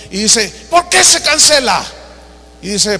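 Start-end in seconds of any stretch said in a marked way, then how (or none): 0.7-1.8 desk-pounding demand. Retold or imitated. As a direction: slam the desk and demand answers. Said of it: "golpea la, la, el escritorio".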